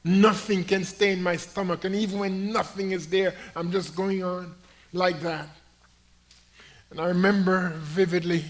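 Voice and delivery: loud ringing pulpit proclamation; full-throated sermon climax; preaching at full force. Acted. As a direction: lift the story to the back pews. Preacher building the testimony.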